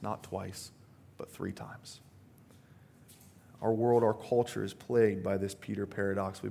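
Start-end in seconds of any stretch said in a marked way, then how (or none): 0.0-2.0 hushed whisper. Real, not acted. Dramatic climax of denial.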